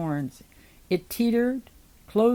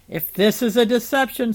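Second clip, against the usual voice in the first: raised, louder warning; irritated ultimatum; angry threat; 4 semitones higher, 5 dB louder than usual. A narrator's reading. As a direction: threaten with a lifted voice. Noah threatens the head.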